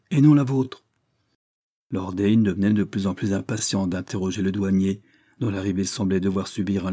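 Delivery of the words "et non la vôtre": high, loud curt snap; blunt retort. A narrator's reading. Sophie curtly rebuffs Lester's question, loud and blunt. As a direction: slap him down with it. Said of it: "sans prendre la peine de déguiser sa pensée".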